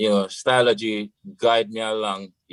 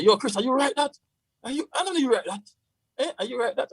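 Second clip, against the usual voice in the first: incredulous friend; 14 semitones higher, faster than usual. Retold or imitated. Quoting cricketer friends' doubt.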